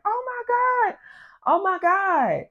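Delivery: very high, drawn-out wail in retelling; mock high-pitched cry of distress; imitating distressed neighbor. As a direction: cry out in a thin falsetto like the panicked neighbor. Neighbor heard crying out from porch.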